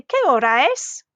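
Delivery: "Qué hora es" dipping in the middle, then swooping up on "es"; "Qué hora es" as an exaggerated question; rising question. Shown as a demonstration. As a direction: ask "Qué hora es" with a big final lift. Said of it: "that was another question".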